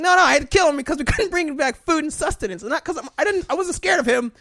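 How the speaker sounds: high, loud defensive whine; squeaky, loud, lame excuse; mock defensive excuse. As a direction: protest your innocence too loudly. Homophobe hunter excuses himself.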